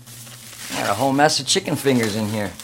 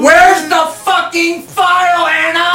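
very high, loud, drawn-out shout; furious yell; 9 dB louder, slower than usual. Acted. Captor demands the file from Anna, furious yell. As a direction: explode at the captive, dragging out each word. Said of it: "don't yell at me".

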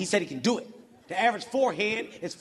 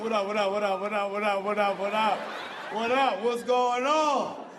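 smug repeated what up, slowing on the end; cocky greeting; 3 semitones lower than usual. Retold or imitated. Brian walking into the wedding, a light cocky greeting. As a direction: stroll in greeting everyone. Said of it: "he was like".

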